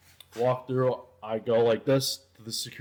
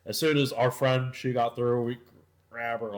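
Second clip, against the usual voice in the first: ordinary talk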